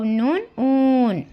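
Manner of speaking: slow, stretched "ऊन" with a long oo sound; drawn-out teacher demo. Shown as a demonstration. Teacher sounding out "ऊन" slowly for young learners. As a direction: stretch the vowel of "ऊन" for the class. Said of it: "अलिफ, वाव".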